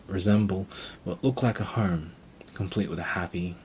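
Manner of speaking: usual speaking voice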